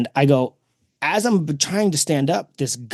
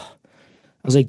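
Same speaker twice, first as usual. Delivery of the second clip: normal talking voice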